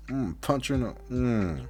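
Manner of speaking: normal talking voice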